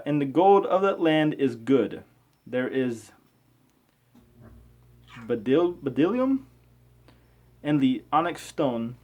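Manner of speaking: slow, measured scripture reading. Acted. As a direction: read it out like a careful lesson.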